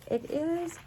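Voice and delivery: very high, amazed realisation; thrilled disbelief. Real, not acted. Crafter realising where the item is from, thrilled disbelief. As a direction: let the voice leap up in amazement. Spots item from missed convention.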